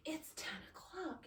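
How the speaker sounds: imitating the husband's hushed whine; whispered protest. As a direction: hiss it like he wants his movie. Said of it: "He's like".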